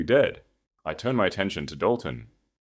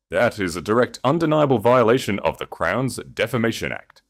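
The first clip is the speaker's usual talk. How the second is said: louder, projected accusation to the room; 5 semitones higher, 7 dB louder than usual. Acted. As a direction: charge him before everyone. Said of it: "Thomas continued flawlessly".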